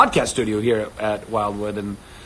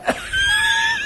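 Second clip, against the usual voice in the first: real high squealing laugh; high laughing shriek. Real, not acted. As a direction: burst into a shrill laugh.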